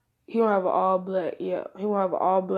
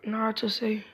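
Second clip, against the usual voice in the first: ordinary talk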